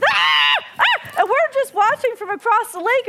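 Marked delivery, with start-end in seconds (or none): 0.0-1.1 loud shouted loon cries; loon alarm calls. Retold or imitated. Loons on the other side, shouted loon cries. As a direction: keep the loons yelling. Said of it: "they're on this side".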